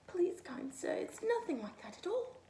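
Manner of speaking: high, tearful little rabbit; weeping rabbit. A narrator's reading. Rabbit begs the angry snowman, high and tearful. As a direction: plead through mild tears. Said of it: "wept the rabbit".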